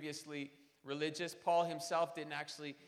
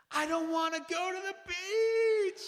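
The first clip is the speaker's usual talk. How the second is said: put-on whiny toddler; 11 dB louder, slower than usual. Toddler refusing the beach.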